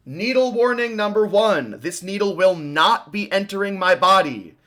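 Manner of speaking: loud, high announcer-like alert; clear audible warning. Real, not acted. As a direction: announce it like a public safety warning. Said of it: "I will make it audibly clear".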